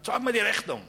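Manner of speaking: loud, high, barked demand; demanding yell. Retold or imitated. Owner demanding a receipt from the photographer, loud and high. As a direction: bark the demand as an angry owner.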